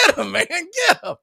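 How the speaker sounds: laughing friend urging him on; egging on. Retold or imitated. Yankees-fan buddy cheering him on, shouting. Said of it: "he's going".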